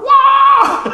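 high, loud mock shriek; mama's shriek. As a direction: squeal it like a shocked mother. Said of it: "Mama might go".

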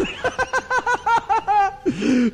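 long rising high laugh; laughing at his own joke. Real, not acted. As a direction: let a high laugh climb.

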